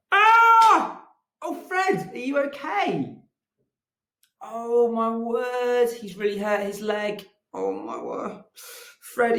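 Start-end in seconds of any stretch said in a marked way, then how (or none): 0.1-1.0 a very high, loud fall cry; a loud, high playful scream. Acted. Fred falls off head. 1.4-5.9 high-pitched alarmed concern; much higher, anxious call to Fred; worried. Acted. Fred hurt his leg.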